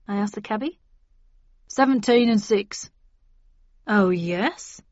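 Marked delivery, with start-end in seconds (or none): 1.7-2.9 cabbie voice. A narrator's reading. Cabbie states fare. 3.9-4.8 dry, sarcastic oh yes. A narrator's reading. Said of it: "I replied sarcastically".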